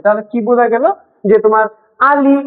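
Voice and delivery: ordinary talk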